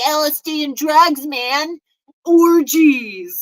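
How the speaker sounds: hammed-up party druggie; mock druggie. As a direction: imitate a tripping reveler, blaring the last word. Mimicking druggies greeting lost kid.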